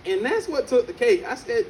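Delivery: normal talking voice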